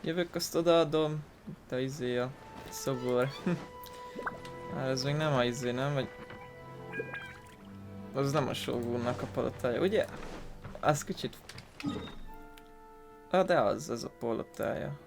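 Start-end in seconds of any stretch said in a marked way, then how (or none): none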